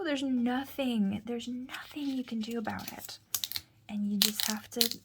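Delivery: ordinary talk, nothing marked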